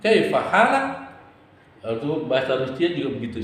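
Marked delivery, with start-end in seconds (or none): 0.0-0.8 "Kaifa halak?" higher and louder, rising at the end; Arabic question demo with a climbing end; rising tune. Shown as a demonstration. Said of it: "Kalau bertanya ke atas".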